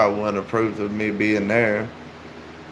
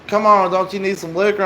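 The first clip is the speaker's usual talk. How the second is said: higher-pitched cajoling, retold; mock come-on, pitched up; imitated coaxing stranger; 7 semitones higher than usual. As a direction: lightly voice a pushy tempter. Stranger pressing liquor on him, retold.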